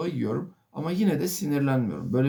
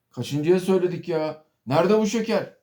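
raised, louder snapping complaint; irritated scolding; 5 semitones higher, 5 dB louder than usual. Retold or imitated. Impatient customer snapping at waiter about the sugar, angry. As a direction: scold the waiter, voice climbing higher.

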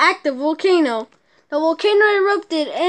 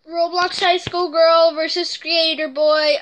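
usual speaking voice

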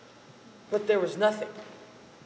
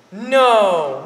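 loud disbelief; 3 semitones higher, 11 dB louder than usual. Acted. Man in bar disbelieving.

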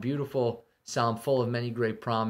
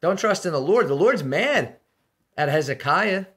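higher, louder taunting speech; mocking enemy envoy; 5 semitones higher, 5 dB louder than usual. Assyrian chief taunting Jerusalem, loud and mocking. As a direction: jeer at them from outside the walls. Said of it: "He says".

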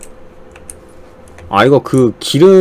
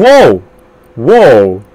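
excited; 2 semitones lower, 6 dB louder, much slower than usual. Real, not acted. Amazed at the game screen.

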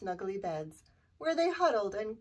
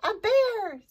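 high, loud storybook shriek; pitched-up exclamation of fright; mock scream; 9 semitones higher, 7 dB louder than usual. A narrator's reading. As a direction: scream it as a frightened boy, for young listeners. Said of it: "screamed Eddie".

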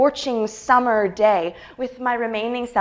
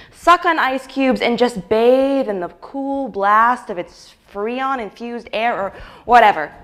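normal talking voice

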